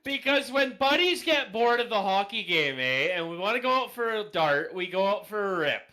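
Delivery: loud, high excited explaining; bursting with enthusiasm. Real, not acted. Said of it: "that got really exciting there".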